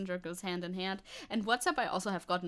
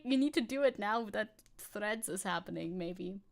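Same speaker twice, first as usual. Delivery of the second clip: usual speaking voice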